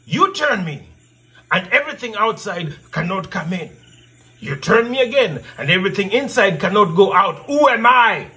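ordinary talk, nothing marked

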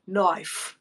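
"Knife" as Aussie "noife"; Australian "Knife", rounded i; Australian accent. Shown as a demonstration. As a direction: say "Knife" with a broad Australian vowel. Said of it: "Yeah, there you go".

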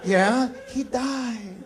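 put-on slow old woman voice; old grandmother voice. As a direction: speak slowly like a tiny grandmother.

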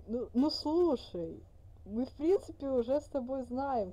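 normal talking voice

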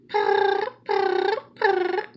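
high, trilled cat purr; kitty cat purring. Shown as a demonstration. Reader showing a kitty cat impression, high trilled purring. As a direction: imitate a cat with short, high purrs. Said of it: "I do a very good kitty cat impression".

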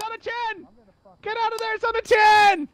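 high loud frantic yells; frantic shouting. Real, not acted. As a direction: shout him out of danger. Urging teammate to escape.

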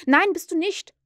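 put-on a boy refusing the truth; stubborn denial. A narrator's reading. Peter denies Wendy married.

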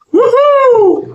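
high, joyful whoop; excited whoop. Real, not acted. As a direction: cheer it out loud. Thrilled at finding a slide.

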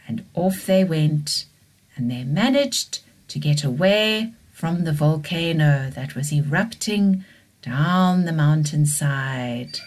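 usual speaking voice

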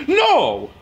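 very high, loud shrieked refusal; defiant kid screaming no. Acted. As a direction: scream no at him. Kid refusing to stop yelling.

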